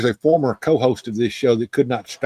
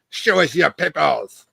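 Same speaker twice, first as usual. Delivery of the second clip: mock German accent, stern demand; faux German accent. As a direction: bark it like a hammy German officer. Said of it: "in my best, uh, faux German accent".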